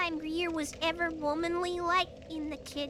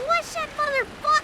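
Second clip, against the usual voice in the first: high, loud, cut-off angry yell; retold yell; 8 semitones higher, 5 dB louder than usual. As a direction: snap at the trainee, breaking off mid-curse. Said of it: "and I yelled at him".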